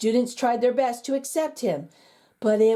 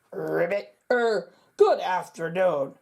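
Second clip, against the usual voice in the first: croaking frog; 2 semitones lower than usual. A narrator's reading. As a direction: croak it like a frog clearing its throat. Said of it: "croaked the frog".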